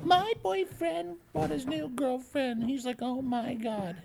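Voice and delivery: squeaky mock girly voice; high silly voice.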